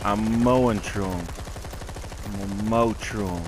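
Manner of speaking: "mowing" and "mow" stretched long and loud; playful drawn-out pronunciation. Pretend. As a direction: lean hard on "mow", holding the vowel. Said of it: "Instead of mowing through 'em".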